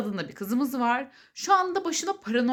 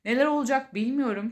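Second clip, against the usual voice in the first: usual speaking voice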